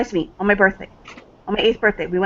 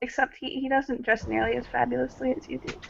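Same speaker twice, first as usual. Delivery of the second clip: much softer, higher, timid reply; shy, quiet daughter; 5 semitones higher, 11 dB quieter than usual. Acted. Daughter answering her father, soft and shy. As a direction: admit it shyly, almost under her breath.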